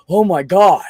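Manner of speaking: sudden high horrified "oh my God"; retelling his shocked outburst; shocked gasp. Retold or imitated. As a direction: blurt it out in sudden horror. Horrified after hurting girlfriend.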